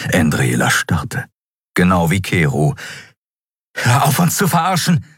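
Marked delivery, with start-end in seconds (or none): 3.8-5.1 erhöhte, aufgebrachte Stimme; herausgeplatzt. A narrator's reading. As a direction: platz empört damit heraus. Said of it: "platzte es aus ihr heraus". (wütend herausgeplatzt)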